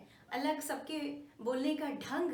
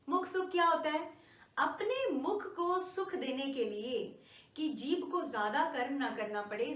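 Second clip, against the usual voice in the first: usual speaking voice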